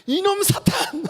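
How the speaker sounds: high-pitched, hammed-up outburst; comic angry rebuke; mock fury. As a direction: play heated anger for laughs. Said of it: "예수님 열받으셨어".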